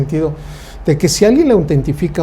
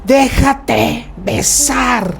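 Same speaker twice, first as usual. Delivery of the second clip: high, very slow, drawn-out command; mock mother yell; 9 semitones higher, much slower than usual. Mother telling child to accept the aunt's kiss, high and drawn out. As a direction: stretch it out like a mom ordering. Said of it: "tu mamá grita".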